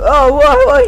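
sudden high startled cry. Real, not acted. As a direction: jump at the door slamming. Startled by door shutting.

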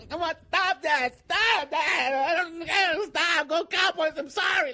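hammed-up yelling, begging him to stop; mock pleading cries. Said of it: "like that".

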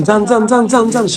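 loud, urgent shout of go, go; shouting at crowd. Real, not acted. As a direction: drive the crowd out loudly. Shooing crowd out of room. (ভিড়কে জোরে চিৎকার করে বের করে দেওয়া)

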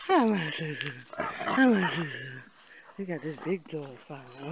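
ordinary delivery

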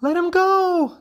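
very high, loud mother frog cry. A narrator's reading. Mother frog to the hawk holding father, high cry. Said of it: "cried mother".

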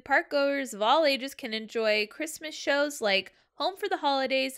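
ordinary delivery

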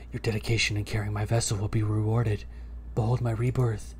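low, soft spooky whisper-voice. Acted. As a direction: sound like a ghostly presence. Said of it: "there's spooky voice".

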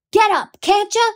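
high loud frantic cry; screaming. A narrator's reading. Brother screaming at his sister frozen on the ground, loud and high. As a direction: scream at her to get up now. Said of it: "Jem was screaming".

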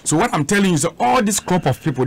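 usual speaking voice

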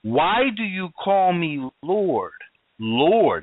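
slow quote with both "Lord"s stretched out; long, weighted "Lord", then a second one on its own; double emphasis on LORD. Acted. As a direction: hit "Lord" twice, pausing between. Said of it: "He puts a double emphasis on it".

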